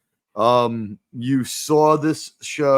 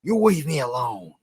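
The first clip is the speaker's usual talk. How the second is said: Cagney-style tough guy; 3 semitones higher than usual. Retold or imitated. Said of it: "James Cagney is like".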